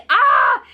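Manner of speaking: high, loud "ah" of outrage; exasperated scream. Real, not acted.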